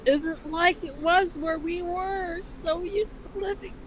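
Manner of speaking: hammed-up cartoon mom reassuring her son; mother's voice. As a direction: read Ma a pitch up with a motherly lilt. Rereads Ma's line.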